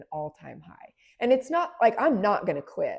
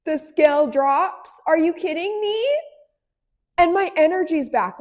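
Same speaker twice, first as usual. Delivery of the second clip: high, loud, laughing disbelief; thrilled disbelief; 10 semitones higher, 10 dB louder than usual. Real, not acted. Thrilled she finally lost weight, high loud laughing disbelief. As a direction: squeal it like you can't believe your luck.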